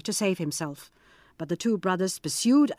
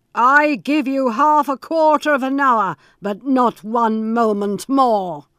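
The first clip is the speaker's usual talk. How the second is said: loud, higher, grudging allowance; harsh Bluebeard; 3 semitones higher, 7 dB louder than usual. Acted. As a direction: bite off the last words slowly. Bluebeard grants brief time.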